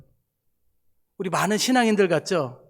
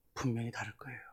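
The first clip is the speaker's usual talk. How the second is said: hushed whisper for emphasis; breathy stage whisper to the congregation; 6 semitones lower, 15 dB quieter than usual. Real, not acted. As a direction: drop to a secret whisper. Preacher drops to whisper.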